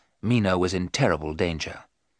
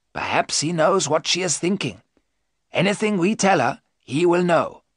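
high-pitched, cautioning professor; warning professor; 8 semitones higher than usual. Acted. Professor warns the men about Mina, insistent. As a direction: warn them in a raised, insistent voice.

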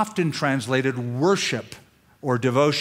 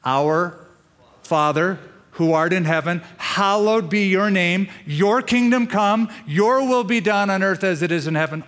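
prayerful recitation; 3 semitones higher than usual. Pretend.

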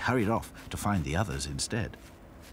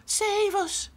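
begging; 4 dB louder than usual. A narrator's reading. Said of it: "begged Lucy".

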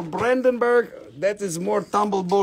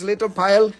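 normal talking voice

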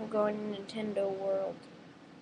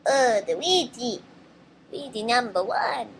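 slightly raised cartoon plumber voice; Luigi; 3 semitones higher, 6 dB louder than usual. Vlogger moving on to the next impression, Luigi voice. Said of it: "And then Luigi voice".

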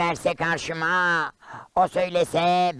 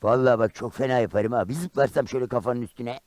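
low, lowered-voice warning; grumbled threat; 6 semitones lower than usual. Acted. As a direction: warn him off in a low tone. Karagöz threatens to jump down.

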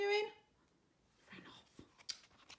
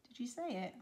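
ordinary delivery, nothing marked

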